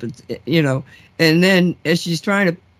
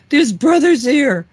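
much higher, louder and slower, each word pressed; insistent exclamation; 9 semitones higher, 5 dB louder, slower than usual. Retold or imitated. Psychic friend insisting to Nancy, higher, louder and slower. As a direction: insist hard on "There's brothers here".

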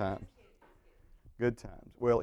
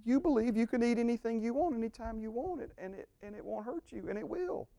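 higher, emphatic retelling of the lie; restating the lie; 10 semitones higher than usual. Shown as a demonstration. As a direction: preach it back high and pointed. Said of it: "Again, it's like".

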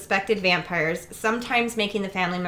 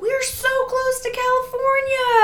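high, loud mock gushing; naive excitement; 8 dB louder than usual. Retelling her move out west, high mock excitement. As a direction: gush like a clueless newcomer. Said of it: "I was like".

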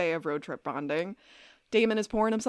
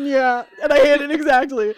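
laughing; 5 semitones higher, 12 dB louder than usual. Real, not acted. Fan reacting, laughing and loud.

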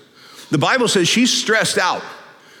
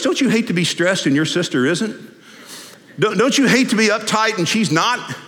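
ordinary talk

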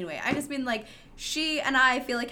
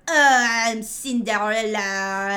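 loud, hammed-up French accent; bad French accent; 10 dB louder than usual. Imagining a Hollywood actress as Cinderella, mock French accent. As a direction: overact wildly in a terrible French accent. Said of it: "having them be like ... doing a French accent".

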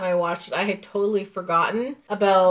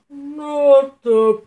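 mock yell; 3 semitones higher, 5 dB louder, much slower than usual. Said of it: "he yells".

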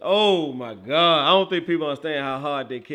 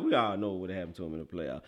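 quick muttered aside; 10 semitones lower, 9 dB quieter, faster than usual. Real, not acted. Dismissive aside.

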